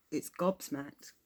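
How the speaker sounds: usual speaking voice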